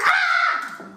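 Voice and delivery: high loud shriek of terror; scream. Acted. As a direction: let out a piercing scream. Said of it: "I scream".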